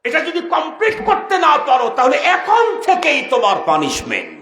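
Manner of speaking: high, loud stern warning; shouted threat. Acted. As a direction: threaten punishment like an interrogating angel. Acts angel threatening punishment.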